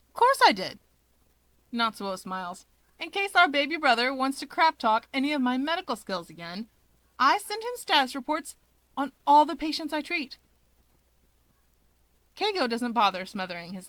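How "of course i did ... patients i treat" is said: higher and louder, gleeful; smug Natsuo. Acted. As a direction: gloat over the snitching.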